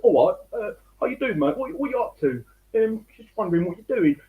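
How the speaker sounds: a hint of English accent, voice lifted high. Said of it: "he had an English accent".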